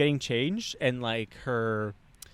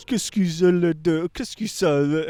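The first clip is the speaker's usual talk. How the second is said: imitated French gibberish; mock French speech; 3 dB louder than usual. French guys at the party addressing him, imitated French. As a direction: rattle off pretend French.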